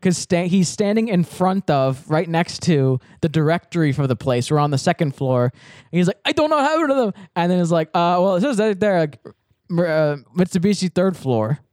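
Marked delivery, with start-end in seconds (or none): 6.2-7.1 put-on high, distressed Indian-accented voice; imitated Indian accent. Lost job seeker at directory, imitated Indian accent. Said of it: "I'll do the voice".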